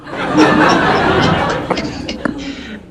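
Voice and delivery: put-on breathy old-timer cackle; a long delighted laugh at his own tale; old man laugh.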